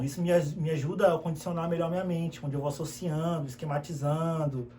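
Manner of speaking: normal talking voice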